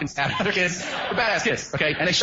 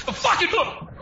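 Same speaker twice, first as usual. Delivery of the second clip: high mock yell; 12 semitones higher, slower than usual. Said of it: "And he goes".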